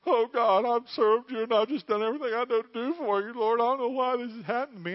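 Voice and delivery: high, loud, fast mock complaining prayer; self-righteous whining prayer. Preacher mocks his own self-pitying prayer, high and whiny. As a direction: whine to God as if wronged. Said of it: "I get so holy and righteous".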